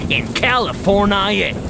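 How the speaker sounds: usual speaking voice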